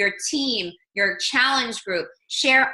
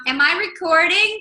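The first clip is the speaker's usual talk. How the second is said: nervous excited; 7 semitones higher, 5 dB louder than usual. Retold or imitated. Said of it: "I was like".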